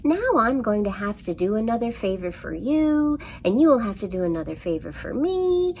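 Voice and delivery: the mouse winds himself up, pitch climbing to a squeak; annoyed rant. A narrator's reading. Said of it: "the mouse said with annoyance".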